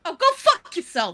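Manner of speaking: loud Jersey tough-guy retort; New Jersey accent. Acted. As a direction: bark it like a Sopranos mobster. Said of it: "can't do it unless I'm actually mad".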